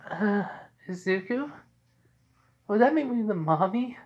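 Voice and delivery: high, hesitant female character voice; Momo. Acted. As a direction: ask tentatively, pitched well up. Girlfriend Momo asks hesitantly.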